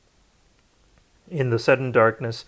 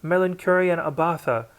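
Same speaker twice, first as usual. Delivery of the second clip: a higher-pitched battle call; battle cry shout; 6 semitones higher than usual. A narrator's reading. Rescuer leaping into the fray, a battle cry. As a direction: rally cry as he charges into the fray.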